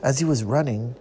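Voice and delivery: usual speaking voice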